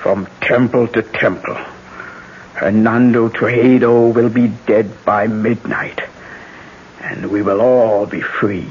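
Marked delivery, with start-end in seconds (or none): none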